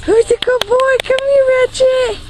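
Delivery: way up high, sweet pet voice; talking to a dog. Real, not acted. Baby talk to dog.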